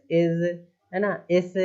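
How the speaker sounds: normal talking voice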